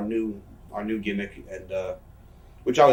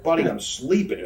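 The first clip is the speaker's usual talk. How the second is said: pitched-up mock exasperation on the phone; retold exasperation; 6 semitones higher than usual. Wrestler retelling an early call from a friend, exasperated. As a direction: fend off an unwanted early caller, retold.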